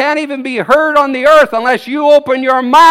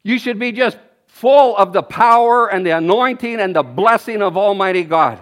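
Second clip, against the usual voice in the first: ordinary delivery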